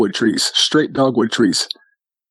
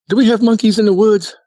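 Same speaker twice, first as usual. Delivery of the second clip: put-on high-pitched, puzzled kid voice; little brother; 11 semitones higher than usual. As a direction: imitate a small boy asking his dad. Voicing his little brother asking Dad.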